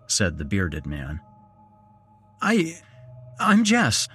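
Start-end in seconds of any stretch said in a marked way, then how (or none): 2.4-4.1 high, faltering nervous introduction; jittery woman introducing herself; panicky. Acted. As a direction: stammer it out in a panic. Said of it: "said the panicky woman".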